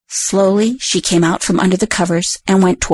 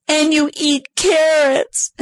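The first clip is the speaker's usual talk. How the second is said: slow, higher scared squeak; trembling critter; 9 semitones higher, much slower than usual. Acted. Critter realises his own name, slow and high. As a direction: critter shaking with fear, said slowly. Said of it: "the critter began to shake".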